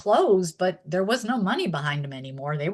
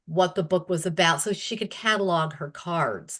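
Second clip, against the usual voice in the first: ordinary delivery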